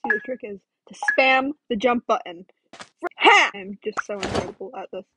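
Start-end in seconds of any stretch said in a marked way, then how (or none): 3.2-3.5 high, loud gloating burst; triumphant shout. Real, not acted. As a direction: crow in victory.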